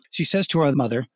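ordinary delivery

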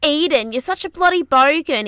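high-pitched mock Australian telling-off; hammed-up Aussie scolding, falling off at the end; Australian accent. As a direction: imitate an Australian reality-show contestant. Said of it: "do it in Australian accent".

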